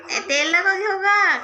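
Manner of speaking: high, slow mock telling-off; drawn-out pretend scold; mock mother's scolding. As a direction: play the mother scolding over the oil, hammed up. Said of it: "आई किती ओरडली असती आता".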